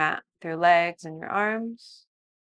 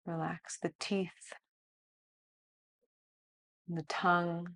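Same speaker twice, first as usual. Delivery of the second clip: softer, slow body-scan guidance; guided meditation; 8 dB quieter than usual. Acted. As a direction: lead a calm body scan.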